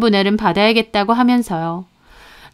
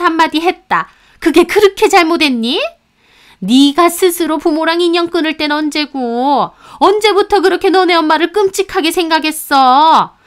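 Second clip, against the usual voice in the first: put-on higher, sharp-tongued older woman scolding; scolding mother-in-law; 8 semitones higher, 5 dB louder than usual. Mother-in-law lecturing her daughter-in-law at the funeral, higher and scornful. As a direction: berate her like a contemptuous mother-in-law, pitched up.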